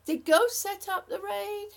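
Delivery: startled question about the raid; surprised question. Real, not acted. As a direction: ask in sudden disbelief. Surprised reading chat.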